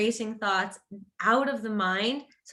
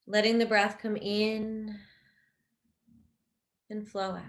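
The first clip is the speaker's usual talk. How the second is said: guided meditation. Real, not acted. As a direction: guide them gently into rest, unhurried and even.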